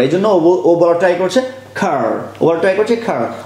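usual speaking voice

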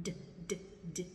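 soft isolated "D, d" sounds; D sound drill. Shown as a demonstration. Teacher drilling students on the D sound. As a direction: tap out the D lightly. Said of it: "teacher drilling the D sound".

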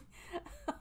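unvoiced giggling; nervous laugh after a fright; breathy giggle. Real, not acted. Laughing after jump scare.

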